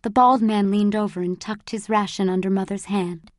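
ordinary delivery

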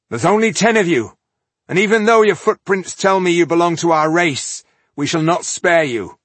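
loud, high stern warning; fierce warning. Acted. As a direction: warn them before a fight. Tungdil threatening the foe.

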